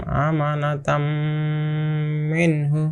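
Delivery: আমানাতাম মিনহু joined, long nasal hum on the m; joined with ghunnah. Shown as a demonstration. Teacher shows the class idgham, আমানাতাম মিনহু hummed long on m. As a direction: hold the closing m of আমানাতাম into মিনহু. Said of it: "মিলিয়ে গুন্না সহকারে পড়তে হবে".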